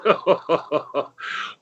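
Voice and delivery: airy unvoiced chuckle at the stranger; a soft breathless laugh; bartender's breathy laugh. Bartender laughing at the late contestant, breathy chuckle. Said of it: "the bartender just laughs".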